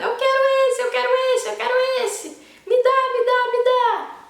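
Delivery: put-on very high, loud wailing child; crying toddler tantrum. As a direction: wail like a toddler begging for a toy. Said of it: "tá chorando". (criança fazendo birra na loja, choramingando bem agudo e alto)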